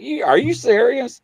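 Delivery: high, loud retold disbelief; freaking out. Guest retells reaction to the demands, freaking out. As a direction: blurt it, pitch leaping up in shock. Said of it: "I'm sitting there freaking out".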